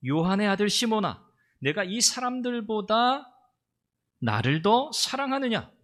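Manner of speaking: high, loud, and slowing on 이 사람들보다; fervent calling out. Retold or imitated. Jesus calling to Peter, high and loud plea. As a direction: cry out the question with full force. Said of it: "예수님께서 베드로에게 가장 먼저 하신 말씀이".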